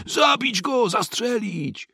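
high frantic cry for blood; crowd yelling. A narrator's reading. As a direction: scream it as an angry mob. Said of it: "wrzeszczeli oni".

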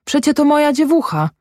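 high-pitched protesting cry. A narrator's reading. Mother tears towards her child's sledge, crying out in protest. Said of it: "zawołała Zośka".

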